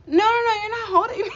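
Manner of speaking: high, fast, yelped protest; frantic denial. Retold or imitated. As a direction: rush to reassure him, high and loud.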